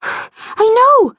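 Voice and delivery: put-on high, puffed-out girl's voice; puffing. A narrator's reading. Kirsty answers her friend while chasing a balloon, puffing. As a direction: huff a breath, then answer high. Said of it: "puffed Kirsty".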